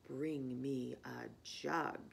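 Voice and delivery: a low, slow voice of the stream; deep slow stream voice. A narrator's reading. Stream answers the fox.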